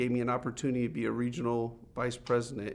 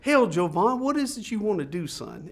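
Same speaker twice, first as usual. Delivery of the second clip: Texan boss impression; 7 semitones higher, 5 dB louder than usual. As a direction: play the folksy Texan owner sizing up the kid. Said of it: "And real country guy, Texan".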